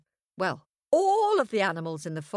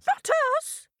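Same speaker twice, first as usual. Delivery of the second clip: much higher tiny-creature voice; squeaky innocent; 3 dB louder than usual. A narrator's reading. Small animals deny.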